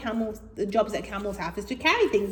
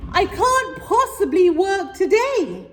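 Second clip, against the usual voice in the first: whiny camel; 10 semitones higher, 6 dB louder than usual. Acted.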